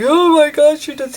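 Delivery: high, loud, teary gasp that fades out; too choked up to finish the sentence; overcome with emotion. Retold or imitated. Mom overwhelmed talking to the lead actor, tearful. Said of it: "I just couldn't compose myself".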